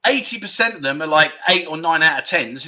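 ordinary delivery

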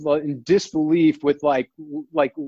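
normal talking voice

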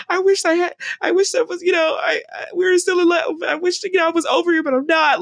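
high, loud melodramatic wailing; dramatic rom-com pining. Acted. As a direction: ham up the lovelorn heartbreak. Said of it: "dramatic kind of moments".

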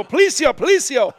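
high, loud call through a door; hammed-up fake police announcement; mock police shout. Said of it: "knock on the door and go".

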